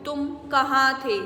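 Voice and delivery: ordinary delivery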